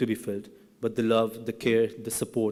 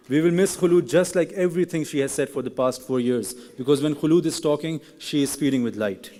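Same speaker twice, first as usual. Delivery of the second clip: ordinary delivery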